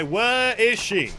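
slow, stretched-out demand; drawn-out question. Acted. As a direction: stretch every word of the question. Repeats game line two ways.

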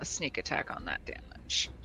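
whispering. Real, not acted. Unvoiced quiet aside.

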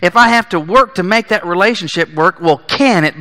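usual speaking voice

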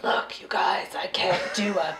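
low, intense mock whisper; hushed, breath-heavy imitation of an actor. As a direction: whisper with exaggerated earnestness. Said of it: "if I'm not whispering intensely".

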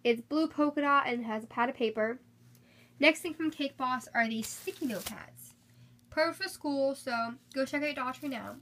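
ordinary delivery

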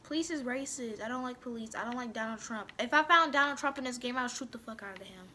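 ordinary talk

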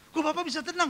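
high, questioning mock-anxious voice; imitated frightened woman. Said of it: "Mukanya takut". (tiruan ibu cemas bertanya, nada tinggi)

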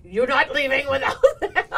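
loud stern order breaking into laughter; firm soldier voice with a laugh; laughing mock order. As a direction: command the plane while laughing. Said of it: "[name], the soldier".

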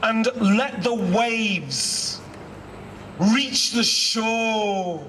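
slow, measured reading with long pauses between phrases; poem recitation. Acted. Poet reciting his poem to assembled students and staff, slow and measured. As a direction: let each image land, unhurried and deliberate.

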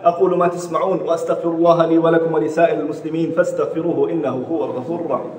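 fast liturgical Arabic recitation; formulaic Arabic closing. Real, not acted. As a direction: rattle off the closing du'a quickly.

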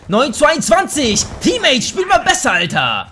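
frustrated gamer outburst; exasperated yelling. Real, not acted. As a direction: scold the useless teammate at a high pitch. Losing badly, berates his teammate.

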